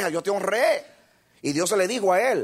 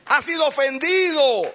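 shouted, high sermon climax; rally the church with every line; fiery preacher hammering each repeated lloren; preaching at full force; 8 semitones higher, 5 dB louder than usual. Acted. Preacher shouting his main point.

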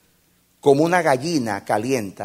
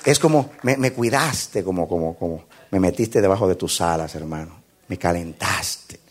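grateful brother; 6 semitones lower, 3 dB quieter than usual. Acted. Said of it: "te va a responder el hermano".

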